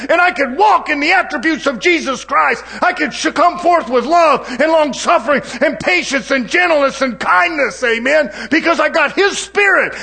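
full-force pulpit preaching; fiery preaching. Acted. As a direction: preach it out to the congregation.

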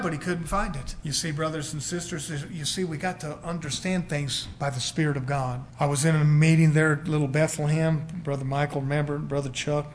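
ordinary talk, nothing marked

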